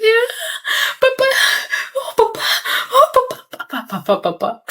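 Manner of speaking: high, wailing mock sobs for papa; theatrical fake sobbing, pitch leaping up; fake crying. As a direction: ham up a fake cry to get dad to stop. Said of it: "je fais semblant de pleurer".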